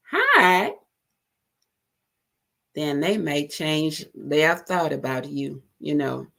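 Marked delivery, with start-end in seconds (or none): 0.1-0.7 high friendly greeting; smiling hi. Shown as a demonstration. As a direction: greet them with a smile. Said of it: "put a smile in your voice".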